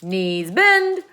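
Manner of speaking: loud drill order; shrieked command. A narrator's reading. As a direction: shout it like a parade ground. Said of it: "she shrieked".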